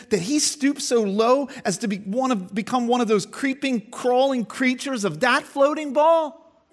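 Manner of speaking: put-on high, indignant young angel; disgusted little angel. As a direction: sneer it in disbelief. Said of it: "in that tone of voice".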